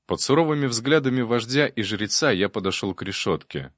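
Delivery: plain reading voice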